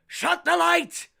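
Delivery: full-volume high scolding shout; loud, high, furious barked orders; yelling. Retold or imitated. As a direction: bellow like a parent at a small child. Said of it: "literally yelling at me".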